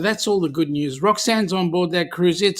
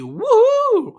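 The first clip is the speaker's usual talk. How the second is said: high, loud whooping cheer; excited whoop; loud woohoo; 6 dB louder than usual. Shown as a demonstration. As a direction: whoop it out big. Reading out the chat's woohoo.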